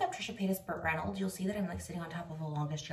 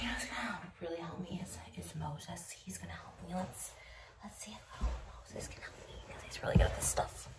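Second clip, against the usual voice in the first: whispering; 2 semitones lower, 6 dB quieter than usual. Real, not acted. Whispering so the fiance won't hear.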